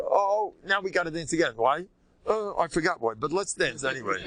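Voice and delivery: mock-innocent, breaking into a laugh; imitated merry chassid. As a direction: play an eager dancer finding any excuse. Imitates chassidim seeking excuse to dance.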